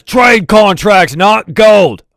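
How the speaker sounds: high, slow, loud shout; dwarf guard yelling to his boss inside. Acted. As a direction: bellow the question back over your shoulder. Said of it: "he says".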